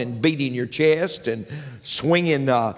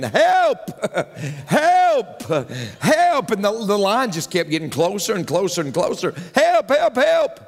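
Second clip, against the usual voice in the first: screaming for help; 4 semitones higher than usual. Retold or imitated. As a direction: yell help in a comic panic. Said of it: "he started screaming".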